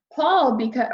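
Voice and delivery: ordinary delivery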